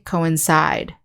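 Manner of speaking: "coincide" stressed on final CIDE, with long I; last-syllable stress. Shown as a demonstration. Teacher showing the verb form, last-syllable stress in "coincide". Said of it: "the stress is on the last syllable".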